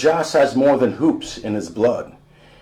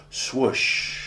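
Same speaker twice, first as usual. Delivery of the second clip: soft, breathy swoosh of the net; whispered swoosh; 4 dB quieter than usual. Acted. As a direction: let the sound slip out like the ball through the net. Ball dropping through the hoop.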